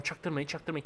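soft, held-down warning to stay hidden; hushed hiding. Real, not acted. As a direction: keep it low so nobody hears. Hiding from players, telling team. (fısıltıya yakın, kısık sesle uyarı)

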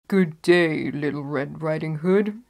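a hint of a loud wolf greeting; sly wolf calling out a greeting; wolf's greeting. Wolf greeting the girl in the wood, lightly voiced. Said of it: "said he".

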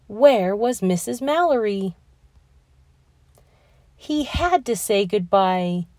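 usual speaking voice

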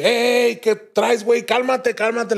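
high-pitched mock yell; acted shout at a dazed friend; retold shouting. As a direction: call out high to calm someone frantic. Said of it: "le empecé a gritar".

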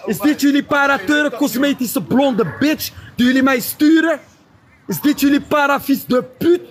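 high-pitched, jeering insults; scornful high crowing; taunting. Real, not acted. Crowing insults about rival to audience.

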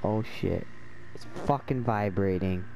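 normal talking voice

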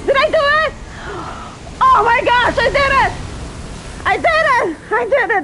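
very high, squealed triumph; ecstatic disbelief. Real, not acted. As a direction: squeal with the shock of finally winning. Just beat the boss.

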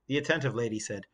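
plain reading voice

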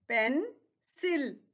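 Pencil very slow, pause between पेन and सिल; two separate chunks in pencil; split into syllables. Shown as a demonstration. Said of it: "इसमें टू syllables हैं". (Pencil को दो हिस्सों में रुककर)

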